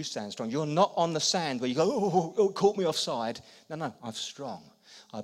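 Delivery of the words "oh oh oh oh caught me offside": mock fluster. Mock flustered man on sand.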